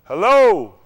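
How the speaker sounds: loud hello into a dead line; calling out hello. Real, not acted. On the call when the line cuts out, a loud hello. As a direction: shout hello down the phone.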